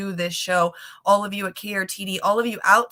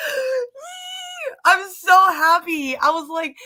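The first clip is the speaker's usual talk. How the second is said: real tears of joy, much higher and louder; crying out with happiness; happy crying; 11 semitones higher, 6 dB louder, slower than usual. Real, not acted. Telling viewers they hit a thousand subscribers, tearful joy. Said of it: "my emotions come out in my tears".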